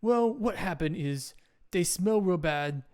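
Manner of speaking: slow, simple caveman explanation; deliberate caveman storytelling; caveman Doug. Acted. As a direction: explain it slowly like a simple cave dweller. Game master plays Doug.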